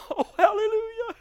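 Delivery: high, breaking cry of joy. Real, not acted. Preacher moved by the son's return, crying hallelujah. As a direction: let the voice crack with happy tears.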